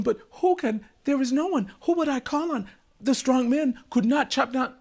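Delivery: high-pitched, fretful king; distressed king. A narrator's reading. The king wonders aloud whom to call, distressed. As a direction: voice a king at a loss, pitched well up.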